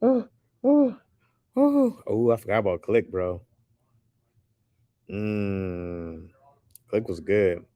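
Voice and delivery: usual speaking voice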